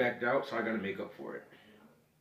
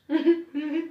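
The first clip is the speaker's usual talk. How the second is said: excited squeak; 7 dB louder than usual. Real, not acted. Pushing friend to consume.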